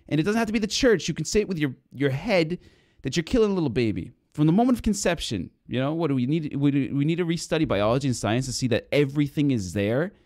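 fiery sermon rant, pitch soaring; preaching at full force. Acted. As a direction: rail against it from the pulpit, voice climbing. Heated preaching on Biden.

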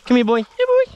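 high sing-song dog call; calling a lost pet. Real, not acted.

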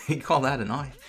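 hammed-up Aussie bushman quoting a knife line; Australian accent. Australian reviewer jokes about knives, in a mock Australian accent. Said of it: "My worst accent ever".

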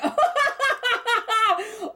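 high giddy burst of laughter; delighted laugh. Real, not acted. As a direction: laugh out in pure joy. Finds the diamond at last.

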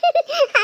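a quick, high, real laugh; short laugh. Real, not acted. Wife laughing at her husband's joke, real laugh. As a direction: burst into a brief laugh at the teasing.

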